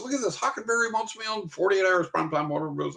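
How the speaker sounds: high, breaking the big news; excited, easing off towards the end; excited retelling. Retold or imitated. Retold excited news to wife.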